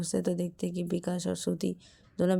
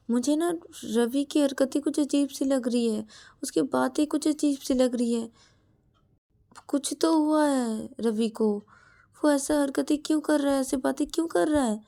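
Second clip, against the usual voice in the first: higher, anxious voice; worried sister; 6 semitones higher than usual. Acted. Shreya tells Shruti she is worried about Ravi, higher and anxious. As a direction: fret about the brother.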